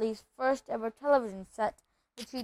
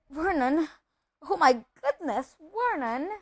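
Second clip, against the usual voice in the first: higher, swooping cry of mild shock; shocked dismay; 5 semitones higher, 3 dB louder than usual. Acted. Aunt to her husband after reading the letter, shocked. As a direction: call out, aghast.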